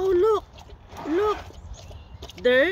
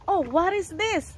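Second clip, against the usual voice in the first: ordinary talk, nothing marked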